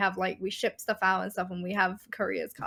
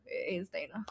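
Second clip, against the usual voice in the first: "Dana" zacht gefluisterd als /teɪnə/; whispered correction; 3 semitones lower, 10 dB quieter than usual. Retold or imitated. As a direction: fluister "It is Dana" beschaamd. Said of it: "And I'm like".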